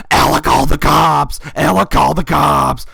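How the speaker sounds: loud, high, slow yell; acted-out panicky scream for someone to stop; imitated screaming. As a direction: shout it out like a screaming kid. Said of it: "he started screaming".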